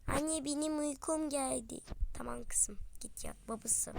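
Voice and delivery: unvoiced bedtime whisper. Daughter and mother whispering at bedtime. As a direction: hush for bedtime.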